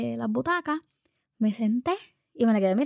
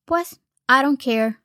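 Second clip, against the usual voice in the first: put-on slow, louder defiant child; mock insolent drawl; defiant kid; 5 semitones higher, 5 dB louder, slower than usual. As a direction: throw it out like a sassy daughter. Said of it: "y me dijo".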